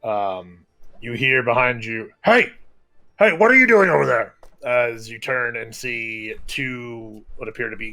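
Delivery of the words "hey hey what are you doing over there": loud, high call across the distance; guard's challenge. Acted. City guard catching someone at the statue, sharp loud challenge. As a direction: shout it quickly to stop someone.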